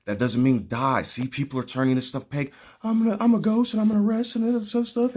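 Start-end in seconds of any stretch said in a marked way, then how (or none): 2.8-5.2 high, fast mocking voice; a much higher, faster, scornful parody voice. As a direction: sneer it like a naive fool. Mocking people who misread him.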